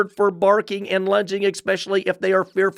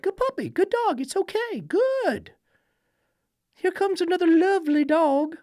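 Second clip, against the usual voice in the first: mocking sweet dog-praise voice; 13 semitones higher than usual. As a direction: coo at the puppy in a mocking sing-song. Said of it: "gentle, cheerful voice".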